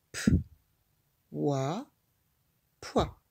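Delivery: letters apart, then "poids" joined; sounding out. Shown as a demonstration. Teacher to child spelling the syllable: letters then "poids". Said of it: "sounding out letters".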